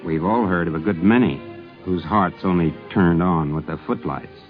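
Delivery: usual speaking voice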